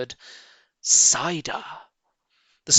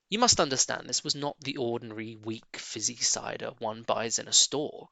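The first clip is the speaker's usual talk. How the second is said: plain reading voice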